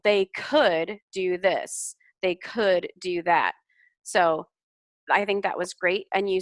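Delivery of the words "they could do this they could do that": could stressed, slow pace; could lengthened and stressed. Shown as a demonstration. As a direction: lean on could to show hypothetical. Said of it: "talking about the hypothetical".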